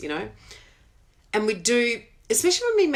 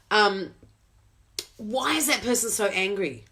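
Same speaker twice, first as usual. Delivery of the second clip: usual speaking voice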